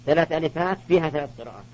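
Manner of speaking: ordinary delivery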